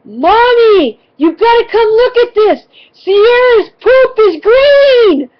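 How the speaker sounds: put-on high, very loud kid holler, ending stretched long; shrieked singsong call from far away; child's yell. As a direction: imitate a little kid shouting urgent news to mom. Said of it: "my youngest child, Cheyenne, yells".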